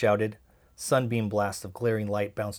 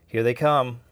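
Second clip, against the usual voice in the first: loud, raised call from a lookout; sharp, much louder alert, pitched up; urgent warning; 4 semitones higher, 11 dB louder, slower than usual. A narrator's reading. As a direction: call out the alarm to the group. Lookout warns police are coming.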